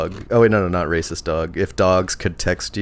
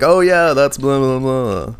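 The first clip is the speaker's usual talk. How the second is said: mock casual reply; 6 semitones higher, 4 dB louder than usual. Mock friends' reaction.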